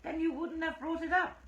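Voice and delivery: loud high counterattack. A narrator's reading. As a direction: snap it back loud and high. Andreja countering in quarrel.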